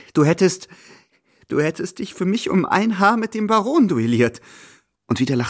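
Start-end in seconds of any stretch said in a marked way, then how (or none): 0.1-4.4 manic. A narrator's reading. Polina half-mad, laughing.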